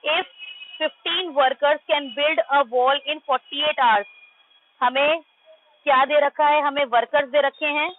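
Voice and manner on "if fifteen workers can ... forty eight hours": slow reading aloud. Shown as a demonstration. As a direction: read each word very slowly. Reading the question aloud to class. (बहुत धीरे-धीरे पढ़कर सुनाना)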